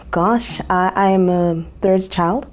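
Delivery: usual speaking voice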